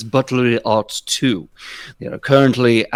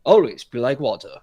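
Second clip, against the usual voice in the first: a hint of a martial-arts sage; mock Bruce Lee wisdom; Bruce Lee quote; 7 semitones higher than usual. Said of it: "Like Master Bruce Lee once said".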